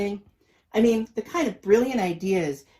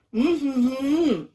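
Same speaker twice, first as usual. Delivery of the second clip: gagged hum; 7 semitones higher, 5 dB louder than usual. A narrator's reading. Gagged sister humming.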